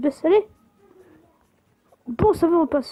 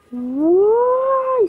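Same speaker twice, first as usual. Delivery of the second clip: long high whoop of joy; excited cheer; 10 semitones higher, 6 dB louder than usual. Real, not acted. Cheering the speed boost.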